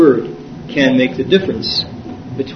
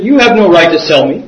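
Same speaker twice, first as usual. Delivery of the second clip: higher, louder stammering protest; imitated indignant outburst; shocked; 5 semitones higher, 4 dB louder than usual. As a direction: challenge him, tripping over repeated words. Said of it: "He was totally shocked".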